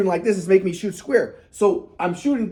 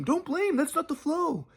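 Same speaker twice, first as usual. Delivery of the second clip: much higher, softer sage voice; grandpa's advice; 8 semitones higher, 8 dB quieter, slower than usual. Retold or imitated. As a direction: hush it like a wise elder.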